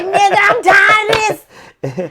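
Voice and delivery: angry shout. Retold or imitated. Wife recalling yelling at husband during the fight, furious shout. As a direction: blow up at him. Retelling her furious outburst.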